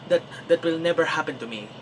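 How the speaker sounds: put-on fast, cocky Filipino accent. Younger self boasting to friend, fast Filipino accent. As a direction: brag in a thick Filipino accent, quickly. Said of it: "as a very innovative Filipino guy".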